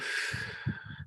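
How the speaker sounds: long, quiet unvoiced exhale; slow breath out. Shown as a demonstration. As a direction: show a slow emptying breath. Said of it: "empty your lungs out slowly".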